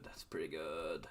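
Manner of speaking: barely voiced aside; whispered. Real, not acted. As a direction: whisper it under your breath. Repeats praise under breath.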